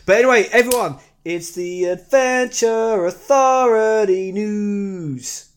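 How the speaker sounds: announcer voice. Pretend. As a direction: announce it like a big show. Announcing the segment.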